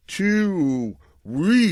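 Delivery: high, loud theatrical echo; hammed-up announcer echo; mock dramatic. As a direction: ham up the words like a trailer. Mock dramatic echo of two weeks.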